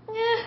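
high, soft, indifferent "eh"; noncommittal "eh". Real, not acted. Answering whether a date would impress, unimpressed "eh". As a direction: shrug it off in a small high voice.

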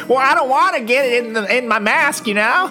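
high-pitched put-on voice of the maskless woman; mock whiny excuse. Co-host mimics the maskless laundromat customer, high mock excuse. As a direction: make a silly high-voiced excuse, laughing.

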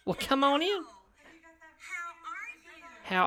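lilting high caretaker impression trailing off; mock nursing home worker. Worker voice again.

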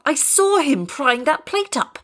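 high, slow, agitated protest; desperate insistence. A narrator's reading. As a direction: insist desperately on being believed. Wilson protests that he saw it.

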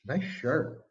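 dry, teasing "Nice shirt." with a falling end; retold deadpan mock praise; sarcastic compliment. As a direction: compliment that sounds like a dig. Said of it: "You're saying it in a certain way that's making fun of it".

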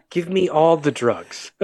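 half-laughing imitation of a wife pleading for drugs; mock wife begging.

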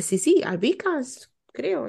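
high, slow, offhand doctor voice; mimicking the doctor. Retelling the doctor's casual answer.

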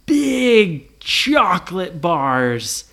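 BIG CHOCOLATE BARS stretched very slowly and loudly; "big" long and booming; relished reveal. A narrator's reading. Narrator reveals the treat.

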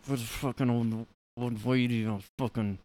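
mock him talking with teeth clamped together; lightly imitating a kid who talks like his jaw's wired shut. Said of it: "like his jaw's wired shut the way he talks".

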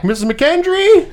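high, loud call across the woods; yelling a name. Retold or imitated. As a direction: call out to her from up the tree. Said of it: "Henry yells back".

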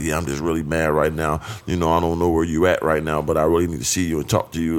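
low, restrained mad husband; retold contained anger. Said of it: "I can hear the anger in his voice".

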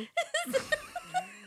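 squealing high-pitched laugh; cracking up at her own failed accent. Real, not acted. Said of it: "it was horrible".

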